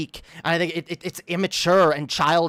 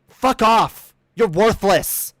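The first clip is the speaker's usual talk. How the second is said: angry dismissal; 2 semitones higher, 4 dB louder, much slower than usual. Real, not acted. Dismisses Paul angrily.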